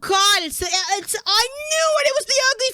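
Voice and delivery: high, loud cry of realisation; shocked outburst. Real, not acted. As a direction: yell out the sudden realisation. Streamer shocked by betrayal.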